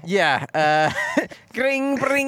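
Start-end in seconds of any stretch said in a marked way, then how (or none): 1.5-2.3 high, buzzy "gring, bring" ringtone; phone ring imitation.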